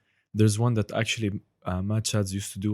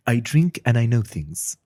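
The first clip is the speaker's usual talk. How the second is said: slow, louder, wry Westeros voice; Game of Thrones impression; 7 dB louder, slower than usual. Shown as a demonstration. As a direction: savour each word, slow and knowing. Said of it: "for those Game of Thrones fans".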